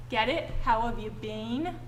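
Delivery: ordinary talk, nothing marked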